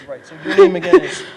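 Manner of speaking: high voice bubbling with laughter; barely holding the laugh in; laughing question. Real, not acted. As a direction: ask through real giggles. Cracks up asking name again.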